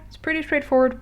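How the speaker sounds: normal talking voice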